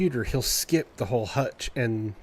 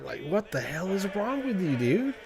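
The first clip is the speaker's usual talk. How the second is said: exasperated; 8 semitones higher than usual. Real, not acted. Annoyed at the yowling cat.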